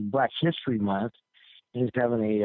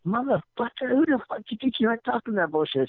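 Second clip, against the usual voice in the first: irate; 11 semitones higher than usual. Retold or imitated. Big man confronts the prankster, irate imitation. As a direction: imitate a man worked up into indignation.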